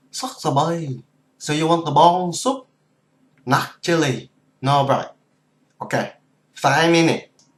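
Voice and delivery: put-on loud Cambodian soup vendor accent; Khmer vendor accent. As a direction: call out orders like a Cambodian street vendor. Khmer vendor calling orders.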